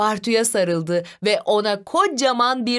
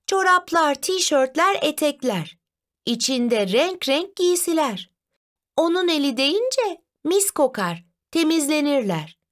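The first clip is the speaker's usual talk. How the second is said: put-on higher child-like riddle reading; lightly voiced Zeynep reading; 6 semitones higher than usual. Sister reads the first riddle to her brother, lightly voiced, higher. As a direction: read the riddle out in a lightly raised girl's voice.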